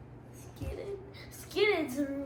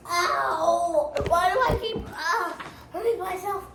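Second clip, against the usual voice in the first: real pained outburst; 2 semitones higher, 5 dB louder than usual. Real, not acted. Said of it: "player hurt in game".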